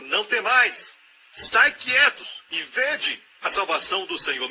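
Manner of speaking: high, loud proclamation; proclaiming Moses. Acted. Moses telling the frightened people to stand firm, loud. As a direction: call it out to the crowd.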